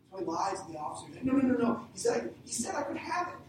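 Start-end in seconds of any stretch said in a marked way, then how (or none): 1.2-3.4 panicked denial. Acted. Thief lying to police.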